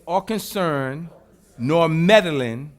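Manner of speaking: loud, slow, word by word; emphatic declaration. Real, not acted. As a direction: pound each word home.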